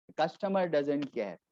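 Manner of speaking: ordinary talk, nothing marked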